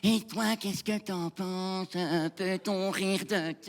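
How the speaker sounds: very slow, drawn-out put-on voice; mocked pestering questioner. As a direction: stretch every word in mockery of the questioner. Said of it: "toujours quelqu'un pour venir te voir". (imitation moqueuse, voix très traînante)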